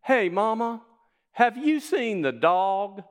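high, loud, slow Southern accent with "Mama" stretched out; slow, laughing Southern drawl. Shown as a demonstration. Preacher mimics a Southern dialect for the church. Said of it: "Same phrase".

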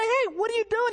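high-pitched acted protest; indignant confrontation. Confronting a friend caught slandering.

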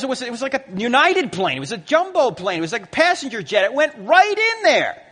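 high, agitated retelling building to a shout; alarmed eyewitness. Retold or imitated. Pastor reporting the jet over the phone, acted high alarm.

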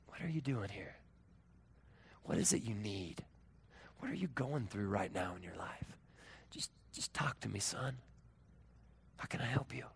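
low, hushed, caring voice; tender fatherly questions. Acted. God asks Elijah what he needs, tender and hushed. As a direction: gently coax him to open up.

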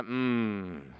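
long, low "mm" groan; closed-mouth groan sinking in pitch; troubled groan. Retold or imitated. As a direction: imitate a man with no words, only a groan. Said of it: "And we're troubled".

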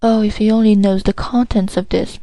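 plain reading voice

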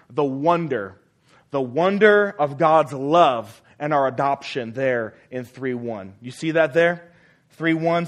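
ordinary talk

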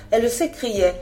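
usual speaking voice

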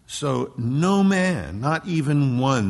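"no man, not even one" very slow and loud; stress on no man. Shown as a demonstration. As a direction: drag out "So no man" word by word. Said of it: "the emphasis".

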